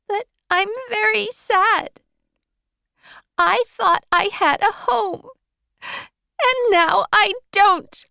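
put-on very high, loud child's wail of despair; shouting in distress. Girl shouts at Marilla that she is not a boy. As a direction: cry it out at full volume, heartbroken. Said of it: "she shouted".

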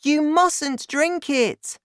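put-on slow, very high boy voice; high, loud, drawn-out warning; alarmed cry. A narrator's reading. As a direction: beg her to stop. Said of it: "George cried".

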